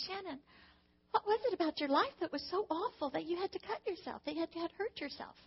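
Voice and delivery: gentle asking. Retold or imitated. Mother gently approaching cutting daughter.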